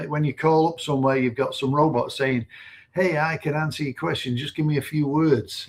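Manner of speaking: normal talking voice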